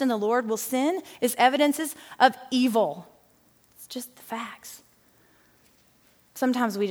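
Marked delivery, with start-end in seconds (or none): 3.8-4.8 unvoiced aside whisper. Real, not acted. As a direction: breathe it as a quiet aside. Aside under breath.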